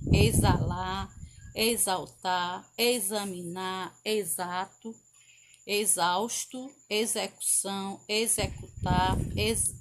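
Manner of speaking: each word read with the x sounding like z. Shown as a demonstration. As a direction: read the list pronouncing every x like z. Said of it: "X com som de Z". (lista lida com X com som de Z)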